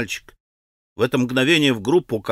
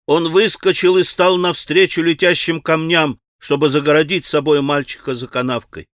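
plain reading voice

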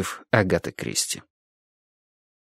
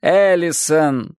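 slow drawn-out call; calling tearfully; 6 semitones higher, much slower than usual. A narrator's reading. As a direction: call her name in distress. Girl sobbing calls her name.

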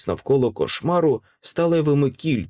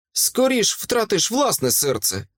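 ordinary delivery, nothing marked

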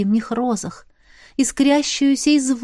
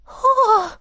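high surprised gasp; a bright startled oh; 12 semitones higher than usual. A narrator's reading. Surprised exclamation. (удивлённое «о»)

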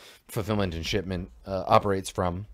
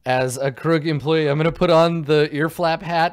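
excited; 6 semitones higher, 8 dB louder than usual. Real, not acted. Teasing the player's disguise plan.

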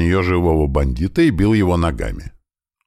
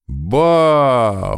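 high, loud surprised "Ба"; surprised exclamation; 9 semitones higher, 4 dB louder than usual. Acted. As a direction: gasp out in surprise. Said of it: "удивлённо развёл я руки".

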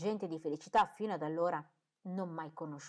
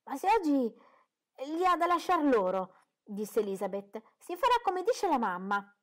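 ironic daughter; 7 semitones higher, 7 dB louder than usual. A narrator's reading. Elizabeth ironic remark.

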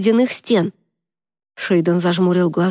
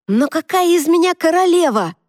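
едва сдерживая крик; 10 semitones higher than usual. Acted. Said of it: "стараясь не сорваться на крик".

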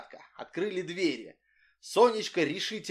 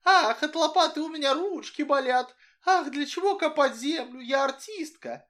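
high, louder mincing mimicry of a spoiled actress; mocking Sonechka; 12 semitones higher, 5 dB louder than usual. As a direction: mimic her airs, sighing ah. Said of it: "mocking Sonechka's whining".

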